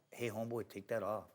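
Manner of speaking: soft low warning from B-Real; talked real low. Retold or imitated. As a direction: say it low and quiet like a calm warning. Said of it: "he talked real low back then".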